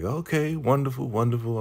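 high, eager waiter's welcome; pleased waiter. Retold or imitated. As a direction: sing out a bright high welcome. Zane pleased with guests.

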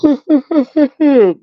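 high-pitched burst of giggling; high laugh. Real, not acted. Laughs at her own remark.

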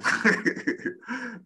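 real laugh bursting out high; laughing at the thought of it. Real, not acted. Husband laughing at wife wanting home, high laugh.

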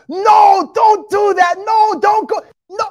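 high, loud pleading at the screen; mock frantic shouting. Preacher acts out viewers outside the movie warning characters, high frantic shout. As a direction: yell warnings like an exasperated moviegoer.

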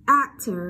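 Actor with a loud open æ at the start; open e vowel. Shown as a demonstration. Teacher models the vowel for class, Actor with open æ. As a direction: hit the æ in "Actor". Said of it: "e bẹt đúng không nào".

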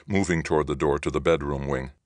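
ordinary delivery, nothing marked

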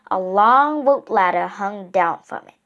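plain reading voice

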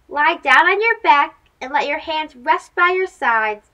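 ordinary delivery, nothing marked

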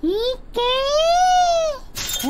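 high shout, charging in; battle cry. Acted. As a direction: yell it as you launch. Charging attack in game.

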